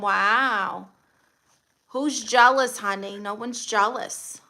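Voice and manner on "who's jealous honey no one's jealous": ordinary talk, nothing marked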